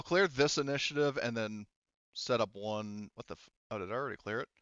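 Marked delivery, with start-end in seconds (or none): none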